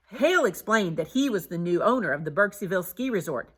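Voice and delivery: ordinary delivery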